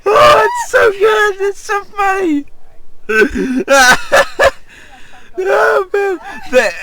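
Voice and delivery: high squealing cry-laugh; crying with laughter. Real, not acted. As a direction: lose it completely at the absurd line. Cry-laughing at absurd story.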